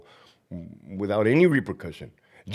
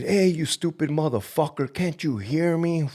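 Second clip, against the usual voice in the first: higher mock taunt, slowing on the end; mock inmate taunt; 7 semitones higher than usual. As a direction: sneer it like the inmate did, drawn out. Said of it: "He said".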